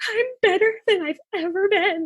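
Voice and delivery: high, squeaky happy-crying voice; mock tearful joy. Person with tears streaming down face, mock tearful joy. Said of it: "tears streaming down my face, like, red face".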